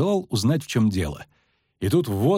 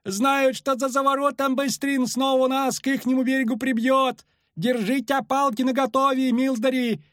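high-pitched, fretful ferryman; anxious wailing; 12 semitones higher than usual. A narrator's reading. Ferryman warns travellers of the current, anxious wail. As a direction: keep up a high wail of warning. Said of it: "застонал паромщик".

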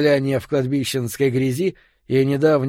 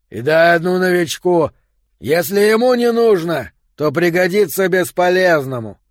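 loud, higher gruff call; shouting; 5 semitones higher, 7 dB louder than usual. A narrator's reading. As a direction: yell down the corridor. Yo shouting across corridor.

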